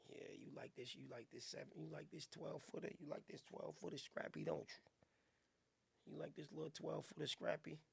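very low, soft, crooning come-on; deep seductive voice. Pretend. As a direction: purr it low like a smooth lover.

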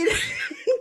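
short, high-pitched burst of laughter. Real, not acted. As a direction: laugh it off quickly. Laughs at the story.

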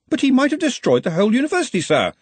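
high, rushed objection; flustered assistant protesting; urgent protest. Acted. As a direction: object quickly, pitched right up. Ponder protests to his boss.

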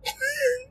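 sudden high squeal of a laugh; high burst of laughter. Real, not acted. Comedian cracking up at his own train joke, high laugh.

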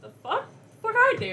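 shocked fan. Retold or imitated. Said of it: "And they're like".

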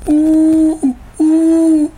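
high, loud drawn-out hooting "ooh"; loon call. Shown as a demonstration. Cohost guessing how a loon sounds, high hooted imitation. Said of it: "Is a loon sort of like a".

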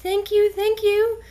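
a tiny creature thanking you; talking kitten voice. Acted. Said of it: "and it goes".

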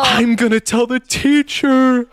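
high, loud, drawn-out schoolkid threat; hammed-up horrified little tattletale; mock tattling kids. Acting kids shocked by the swear.

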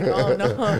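loud laughing mock panic; laughing retold panic. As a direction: laugh out a scared oh no. Scared of kitchen fire, laughing.

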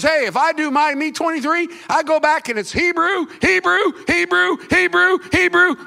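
high-pitched, punched-out brag; mock boasting. Preacher voices Paul boasting of lineage.

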